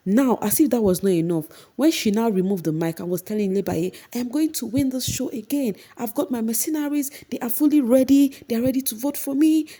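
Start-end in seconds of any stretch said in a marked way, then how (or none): 4.1-9.7 much higher confident boast; hammed-up bragging housemate; boastful Mercy. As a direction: brag in a high mocking voice. Mercy boasting about winning.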